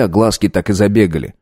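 ordinary delivery

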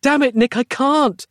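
very high, strained protest; frantic yell. A narrator's reading. Roz starting to lose control, yelling. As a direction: cry out as control slips. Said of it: "she yelled".

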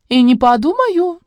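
high, slow laughing reply. Acted. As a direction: laugh it off playfully. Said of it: "засмеялся он в ответ".

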